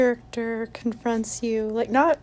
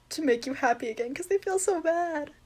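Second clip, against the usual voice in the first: normal talking voice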